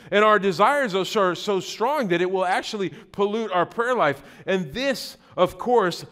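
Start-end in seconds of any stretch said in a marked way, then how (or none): none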